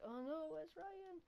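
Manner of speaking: put-on high, slow, fretful girly voice; mimicking worried girls. Girls searching for the hidden boy, mock high worried girly voice. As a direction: play a panicking girl calling out. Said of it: "and like".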